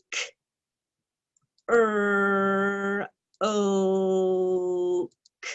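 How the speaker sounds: sounds spaced out one by one; slow careful sounds. Shown as a demonstration. Teacher sounding out croak slowly for students. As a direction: say each sound slowly and apart. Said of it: "sound it, uh, carefully".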